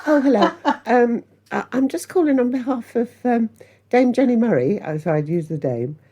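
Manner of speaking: high, polite secretary's phone voice; posh secretary. Retold or imitated. Pretending to be her own secretary on the phone, high and polite. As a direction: put on a lightly posh assistant booking a table. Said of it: "pretend I was my secretary".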